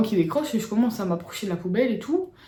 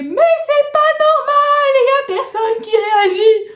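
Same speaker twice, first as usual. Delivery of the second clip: high, loud, slow sing-song yell; imitated yelling man; 7 dB louder, slower than usual. Passer-by yelling about the neighbourhood, hammed-up high shout. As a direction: ham up a panicking passer-by shouting down the street. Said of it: "Et commence à crier".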